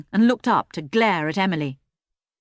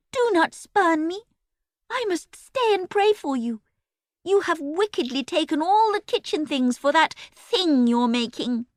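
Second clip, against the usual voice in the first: put-on pious girl voice; 10 semitones higher than usual. A narrator's reading. Pious Emily continues.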